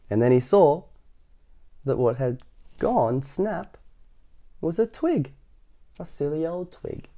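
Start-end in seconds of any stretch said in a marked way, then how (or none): none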